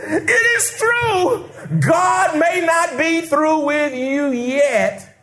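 high, slow laughing cry; high shouted laughter. Real, not acted.

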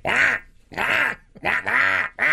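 high honking mock cough; goose-honk cough. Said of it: "he sounds like a goose".

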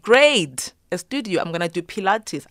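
a retold squeal of delight over the studio; excited. Recalling finding a yoga studio in Thailand, thrilled and loud.

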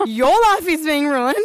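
slow, sarcastic repeat; mock sarcastic echo. Mum mocking the neighbour's complaint back, slow sarcasm. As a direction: throw his words back at him. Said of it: "And I was like".

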